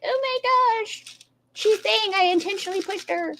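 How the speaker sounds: mocking her hysterical wail to her mom; mock hysterical crying. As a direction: parody her hysterical phone call to mom. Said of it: "Amber starts crying and calling her mom hysterical".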